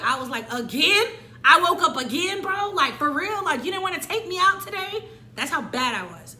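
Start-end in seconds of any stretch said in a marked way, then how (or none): 0.5-5.1 higher, louder, fed-up complaint to God; exasperated. Retold or imitated. Speaker complaining to God about waking up, exasperated and loud. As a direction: whine at God for waking you again.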